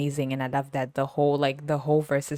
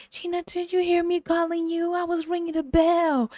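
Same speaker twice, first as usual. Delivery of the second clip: high, put-on old man's voice calling Gina; mock old man calling; 14 semitones higher than usual. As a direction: whine it like a needy old man ringing for help. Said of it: "And he's like".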